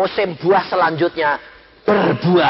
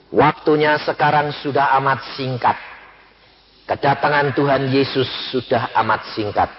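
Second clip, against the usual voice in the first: ordinary talk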